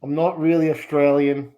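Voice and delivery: a wobbly put-on Australian accent drifting toward Scottish; shifting mock Australian accent. Shrek chats with the show host in a shaky mock Australian accent. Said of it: "we're getting you in Scottish, sometimes it's Ukrainian".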